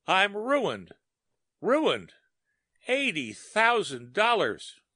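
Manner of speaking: high, stunned, anguished lament; despairing. A narrator's reading. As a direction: wail over the loss. Craig ruined, despair.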